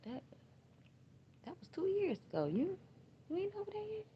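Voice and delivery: high, sneering imitation of a callous friend; mock disbelief that grief still lasts; mocking the dismissive person. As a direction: mimic them high and incredulous. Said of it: "Somebody actually told me".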